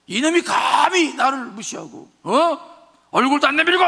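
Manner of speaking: high, loud shout of wounded pride; 나만 raging. Acted. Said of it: "그러면서 막 화를 내는데". (무시당해 화내는 장군의 고함)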